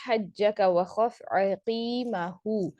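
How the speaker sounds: slow, drawn-out Quranic recitation; tajweed recitation voice. Shown as a demonstration. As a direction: recite each letter slowly and deliberately. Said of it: "recites Quranic example in lesson".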